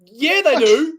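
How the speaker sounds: mock retort. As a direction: shoot back a smug obvious answer. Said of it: "And I went".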